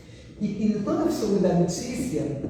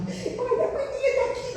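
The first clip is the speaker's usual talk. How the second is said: playful daughter; 3 dB quieter than usual. Retold or imitated. Filha brincando com a barriga.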